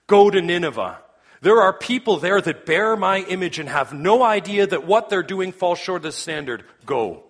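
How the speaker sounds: loud, high, urgent divine command; commanding proclamation. Acted. God sending Jonah to Nineveh, commanding proclamation. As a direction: thunder the order as God sending a prophet.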